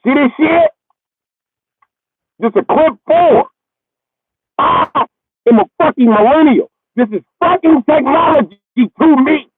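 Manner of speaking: put-on high, loud scoffing young voice; mock snooty kid. Kid scoffing at dad's speaker, high and loud. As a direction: sneer like a kid at old tech.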